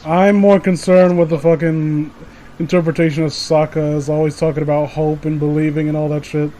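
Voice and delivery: ordinary delivery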